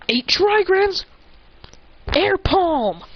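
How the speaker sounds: high-pitched attack name call. Retold or imitated. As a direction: call the move like a mock anime fighter. Calling out an attack.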